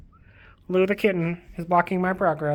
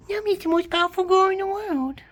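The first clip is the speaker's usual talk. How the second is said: very high, cooing praise; squeaky adoration. Pretend. Praising her cat Nami in a very high squeak. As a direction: squeal it adoringly.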